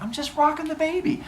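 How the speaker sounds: put-on high, silly baby-bouncing voice; high-pitched playful excuse with whoops; feigned innocence. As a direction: act innocent while jiggling a baby. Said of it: "He's like".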